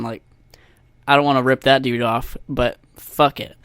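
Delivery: ordinary delivery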